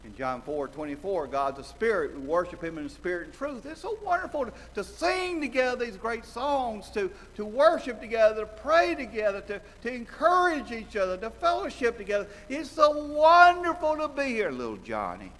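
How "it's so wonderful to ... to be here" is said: high, loud rapturous praise; gushing joy. Acted. Preacher praising worship together, high and gushing. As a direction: swell with delight.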